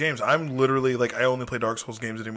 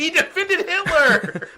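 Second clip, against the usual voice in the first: slow, high, laughing; 6 dB louder, much slower than usual. Real, not acted. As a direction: laugh through the words. Teasing friend, laughing hard.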